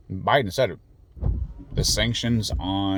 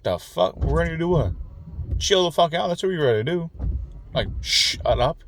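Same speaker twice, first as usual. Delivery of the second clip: exasperated outrage; 9 semitones higher than usual. Real, not acted. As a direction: scoff in disbelief at the idea. Outraged reply to war talk.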